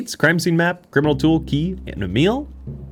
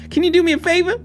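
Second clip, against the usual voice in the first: put-on pitched up bunny girl pleading; high Susie Rabbit voice again; 12 semitones higher, faster than usual. Susie Rabbit pressing the detective again, high pitched.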